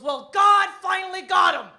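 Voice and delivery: loud, high triumphant proclamation; elderly mother quoted. Retold or imitated. Mother gloating over her ex to daughter, loud triumph. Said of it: "She goes".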